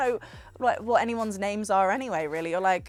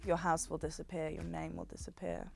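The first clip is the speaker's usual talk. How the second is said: creepy; 2 semitones lower, 11 dB quieter than usual. Retold or imitated. Imitating a fan quoting the show at her, creepy. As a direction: drop much softer, imitating a creepy stranger. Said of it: "said it like that".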